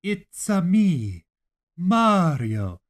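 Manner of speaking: slow, loud hammed-up Italian accent; mock Italian plumber voice, slow and loud; mock Mario accent. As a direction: drag it out like a cartoon plumber. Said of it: "surgical process where it's like".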